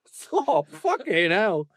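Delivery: high-pitched disbelieving echo; incredulous. Real, not acted. Reader reacting to a chairman's absurd surname, incredulous.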